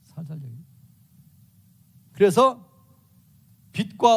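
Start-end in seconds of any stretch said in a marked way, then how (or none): 0.0-0.6 much softer, tender demonstration; soft, gentle talking the way he advises; gently. Shown as a demonstration. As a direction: speak it softly like soothing someone. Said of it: "조곤조곤 얘기해요".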